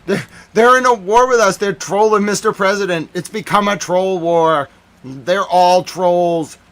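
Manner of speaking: shouting. Real, not acted. Ranting at the chat about a troll war, shouting. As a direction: yell it in disbelief.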